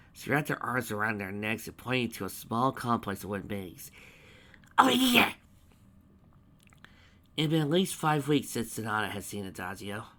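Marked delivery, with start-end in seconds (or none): 4.8-5.3 high, loud eager cry; excited. A narrator's reading. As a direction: burst out with delight. Pinkie eagerly pointing.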